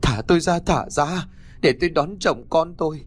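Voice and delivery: crazed wailing. Acted. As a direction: wail as if possessed. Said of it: "gào lên như hóa giải".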